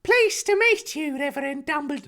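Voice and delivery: high prim woman's voice; Petunia voice. Acted. Petunia greeting Dumbledore, wrong high voice. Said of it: "No, that's once again, that's Petunia".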